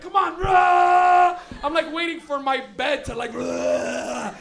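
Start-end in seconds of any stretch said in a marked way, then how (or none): 0.0-1.4 loud, high, drawn-out roar; straining power-up yell. Retold or imitated. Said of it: "in the mirror like". 3.3-4.3 loud straining roar; power-up roar. Retold or imitated. Kid trying to power up.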